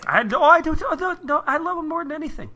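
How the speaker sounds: gushing. Acted. Gushing over Dana.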